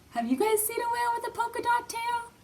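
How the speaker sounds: very high, fast playful squeal; excited. Acted. Teacher playfully asks the kids about the whale. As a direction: gush at the kids.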